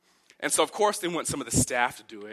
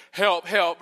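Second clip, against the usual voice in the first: mock cry for help; 5 semitones higher, 6 dB louder than usual. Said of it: "too embarrassed to say".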